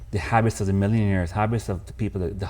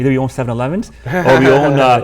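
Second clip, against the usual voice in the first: playful loud rant; 4 semitones higher, 9 dB louder, faster than usual. Real, not acted. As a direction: belt it out for the laugh. Joking about Pakistani Indian jobs.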